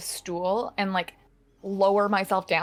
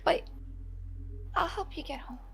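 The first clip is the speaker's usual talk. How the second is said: high, quiet and earnest; soft sincere promise; 6 semitones higher, 3 dB quieter than usual. Acted. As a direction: promise it gently like a fairy. Fairy swears an oath softly.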